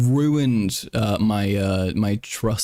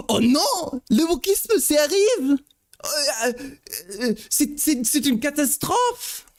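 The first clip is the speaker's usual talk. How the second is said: gasping, very high mock terror; hammed-up French panic. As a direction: play a melodramatic Frenchman facing disaster, stammering in alarm.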